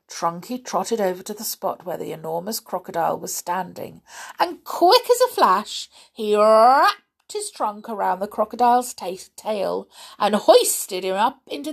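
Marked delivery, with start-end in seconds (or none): none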